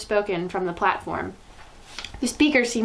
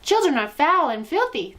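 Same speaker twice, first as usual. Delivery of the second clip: booming witch proclamation, raised high; thundering; 7 semitones higher, 4 dB louder than usual. A narrator's reading. Grand High Witch thundering at her witches. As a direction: thunder it at the crowd. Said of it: "thundered the Grand High Witch".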